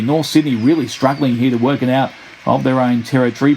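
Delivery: ordinary talk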